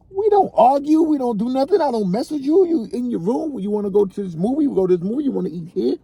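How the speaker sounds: exasperated father's rant; getting pissed. Real, not acted. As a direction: defend yourself, rising annoyance. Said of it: "I'm starting to get pissed".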